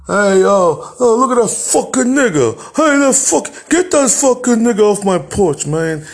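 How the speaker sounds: high, loud jeering street voice; loud mocking Italian bullies. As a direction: hurl the insults from a passing car. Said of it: "they would talk like that".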